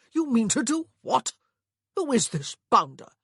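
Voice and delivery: loud, high, scandalised brother; outraged shock. A narrator's reading. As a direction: demand it in loud disbelief. Shocked brother finds his tongue.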